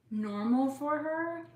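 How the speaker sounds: NORMAL drawn out, high and loud, in air quotes. Real, not acted. Vlogger stressing that her daughter will feel normal, in air quotes. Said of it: "I'm gonna use quotes normal".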